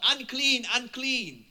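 imitated warning shout. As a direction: call it out like a warning. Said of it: "they have to shout".

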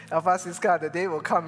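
loud joking proclamation; mock prophecy. Pastor kidding the congregation, loud mock prophecy. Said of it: "not, not prophesying".

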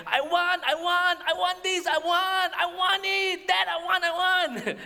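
very high, loud, insistent whine; whining kid. Acted. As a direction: throw a child's tantrum at Dad. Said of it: "a kid whining there".

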